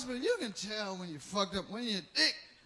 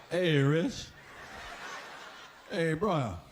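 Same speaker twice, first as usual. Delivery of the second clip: a hint of a lower, casual brother; lightly voiced brother greeting; 7 semitones lower, much slower than usual. Brother asks for autograph.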